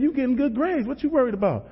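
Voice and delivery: high, lightly voiced peer pressure; persuading schoolmate. Schoolmates coax him to skip school, dismissive.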